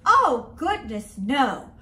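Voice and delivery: ordinary delivery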